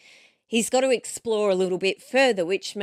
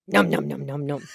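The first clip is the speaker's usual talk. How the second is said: mock munching; 7 semitones lower than usual. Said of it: "And he goes".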